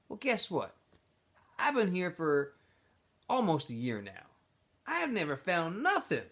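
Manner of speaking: a smooth-talking camp leader pulling him aside; X-Ray's voice. Acted. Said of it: "said X-Ray".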